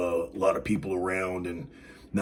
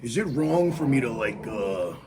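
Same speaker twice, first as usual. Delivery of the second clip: ordinary talk, nothing marked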